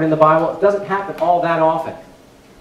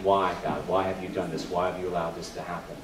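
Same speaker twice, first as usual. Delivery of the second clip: quiet pleading; 9 semitones lower, 10 dB quieter than usual. Acted. Job's question, retold softly.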